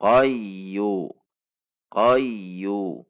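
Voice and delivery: "qayyu" gliding through a doubled y, neither jerked nor dragged; correct smooth qayyu. Shown as a demonstration. Teacher models the right reading, smooth doubled y. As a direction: lean into the y of "qayyu" and release evenly.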